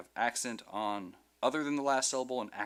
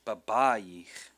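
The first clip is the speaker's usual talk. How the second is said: "Babayich" with the second BA louder; stress before last syllable; 6 dB louder than usual. Shown as a demonstration. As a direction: punch the middle syllable of "Babayich", ba-BA-yich. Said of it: "with the accent not on the last syllable".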